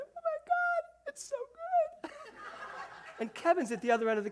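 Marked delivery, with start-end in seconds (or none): 0.0-2.1 very high, slow, teary squeal; overcome, teary and squealing. Retold or imitated. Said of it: "I am sobbing and laughing". 2.2-3.1 airy high-pitched giggle; breathless high laugh. Retold or imitated. Laughing while reading her play.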